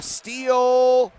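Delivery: high, loud, stretched-out call; excited play-by-play. Real, not acted. Commentator calls a sudden steal in live play, excited and drawn out. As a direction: draw out STEAL with rising excitement.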